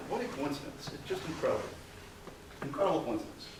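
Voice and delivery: dry sarcasm, softly delivered; sarcastic. Real, not acted. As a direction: feign wonder in a quiet, knowing aside. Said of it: "notice the sarcasm in my voice".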